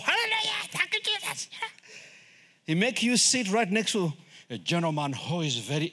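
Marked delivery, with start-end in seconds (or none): 0.0-1.5 very high, squealed praise; ecstatic worshipper. Acted. Worshipper praising beside a dignified gentleman, high-pitched ecstatic squeal. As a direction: mimic a worshipper overcome with joy.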